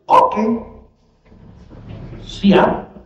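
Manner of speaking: high, curt cocky acceptance; defiant Iblis. Retold or imitated. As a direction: accept the sentence brazenly. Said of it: "Jawaban Belis juga".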